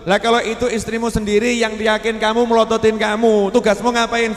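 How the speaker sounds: loud, high fiery sermon; loud preacher counting out the steps; preaching at full force. Acted. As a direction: drive each point home to the crowd.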